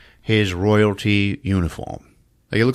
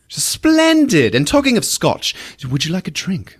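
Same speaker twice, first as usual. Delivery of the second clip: mock posh hostess; 8 semitones higher than usual. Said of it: "She's like".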